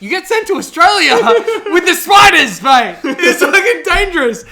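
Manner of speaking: high, loud comic outburst; excited shouting. Real, not acted. Telling co-host about deadly Australian exile, excited shouting. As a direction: shout it, breaking into laughter.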